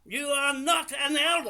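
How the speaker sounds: loud, high, indignant contradiction; real outraged shout; angry shout. Real, not acted. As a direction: shout down their claim. Contradicting caller's claim in heated quarrel.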